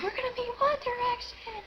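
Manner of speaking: very high excited shriek; high retold fangirl squeal; excited scream. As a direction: shriek the news like a thrilled fan.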